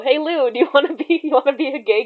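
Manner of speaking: playful pitch to a friend. Pretend. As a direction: jokingly sweet-talk a friend into a favour. Acts out asking friend for role.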